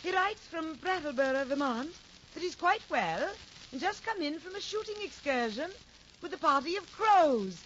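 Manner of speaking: loud, projected stage declamation; theatrical stage actress. Acted. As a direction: play it big for the back row. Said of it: "One of the ladies says".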